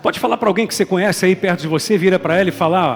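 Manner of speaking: loud, driving preacher's call to the crowd; loud preaching. Real, not acted. Pastor urging the church loudly to turn to a neighbour. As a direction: push the crowd to turn and speak.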